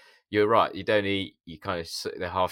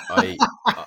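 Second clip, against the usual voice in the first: laughing; 9 dB louder than usual. Real, not acted. Laughing before telling the story.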